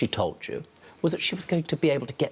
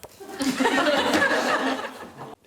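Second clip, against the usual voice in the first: high burst of real laughter; a big laugh at his own joke; 10 semitones higher than usual. Real, not acted.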